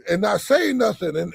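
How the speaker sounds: ordinary delivery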